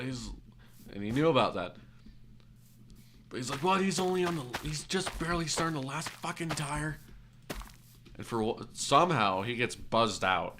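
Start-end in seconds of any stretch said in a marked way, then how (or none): 3.6-7.0 high, put-on indignant disbelief; wigging out. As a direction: mimic a customer's incredulous rant. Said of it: "just starts wigging out".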